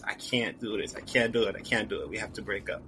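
soft, subdued imitation of a crying partner; hushed retelling of a tearful breakup; retold tearful plea. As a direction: quote the breakup quietly, drained and defeated. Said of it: "start crying again, and goes".

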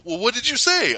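high-pitched acted query; mock questioning. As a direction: press them in a mock squabble. Acting out film's back-and-forth.

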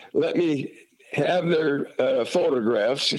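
ordinary talk, nothing marked

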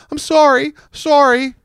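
put-on very high child's voice, small and tearful; high whimpered apology of a little kid; little boy crying sorry. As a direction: squeak it out like a scared toddler. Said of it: "you can just hear him saying".

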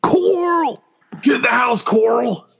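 high mangled "Coral" yelled at son; imitating a drawled "Coral, get in the house"; mock Rick Grimes yell. Friend mocking a TV dad calling his son: yelling in a put-on accent. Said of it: "same thing as fucking Coral".

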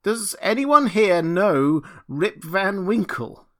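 loud, high, slow plea; despairing cry. A narrator's reading. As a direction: cry out desperately to the crowd. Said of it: "cried out in despair".

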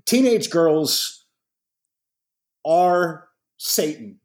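drawn-out deadpan pronouncement; slow comic emphasis. Real, not acted. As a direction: stretch it for the punchline. Dad jokes about teenage daughters.

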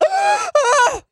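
high squeaky gasp of shock. Retold or imitated. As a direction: gasp like you just heard the twist. Everyone gasps at the announcement.

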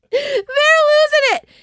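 very high, squealing through laughter; laughing. Real, not acted. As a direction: squeal it out while cracking up. Cracked up at her joke.

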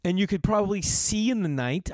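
sarcastic retort. Retold or imitated. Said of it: "And I'm like".

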